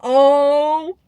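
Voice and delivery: loud high oh; startled loud exclamation; surprised oh at the shaved dog. Real, not acted.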